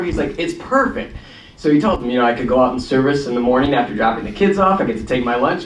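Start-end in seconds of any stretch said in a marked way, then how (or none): 0.4-1.1 retold delight. Reed delighted with his plan.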